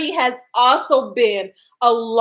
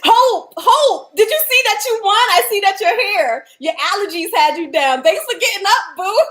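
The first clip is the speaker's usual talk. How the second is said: excited call-out; 9 semitones higher than usual. Real, not acted. Host thrilled to tell Hope she won, calling out excitedly. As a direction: call her name out with delight.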